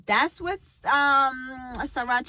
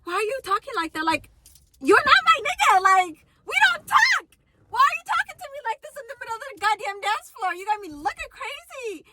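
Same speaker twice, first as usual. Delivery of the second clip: high, loud exasperated scolding; mock exasperated rant; 11 semitones higher, 5 dB louder than usual. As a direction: tell him off in disbelief. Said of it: "he would be like".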